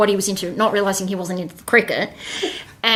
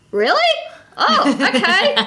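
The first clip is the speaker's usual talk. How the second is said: surprised; 11 semitones higher, slower than usual. Retold or imitated. Surprised he is back.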